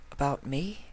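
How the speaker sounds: flustered high mare voice; stammering Octavia. Acted.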